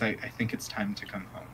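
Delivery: soft, quick whisper. Acted. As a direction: whisper it gently. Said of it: "Euphemia walks up to Jiraiya and says".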